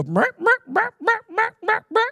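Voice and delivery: very high, repeated bleat-like call; shepherd's sheep call. Retold or imitated. Shepherd calling sheep to the right, high imitated call. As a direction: imitate a shrill herding call in quick repeats. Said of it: "one sound for the sheep".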